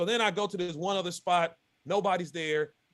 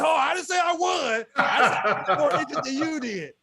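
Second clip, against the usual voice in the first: higher, louder, laughing through it; laughing correction; 7 semitones higher, 5 dB louder than usual. Real, not acted. Angler correcting his buddy Mike, laughing protest. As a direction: laugh as you set the record straight.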